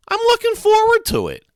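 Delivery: put-on very high, loud kid voice; high-pitched boy's bold claim; eager boyish bravado. A narrator's reading. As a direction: declare it in a squeaky, eager voice. Freak boasts, voiced in story.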